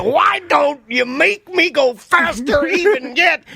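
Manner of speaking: high, drawn-out mock outcry; hammed-up old man's wail; imitating old man. Repeating old man's cry from clip.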